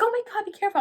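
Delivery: high-pitched startled warning; alarmed. Retold or imitated. Officer reacting as she almost fell, alarmed. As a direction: imitate someone gasping out a caution.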